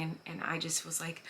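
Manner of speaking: ordinary talk, nothing marked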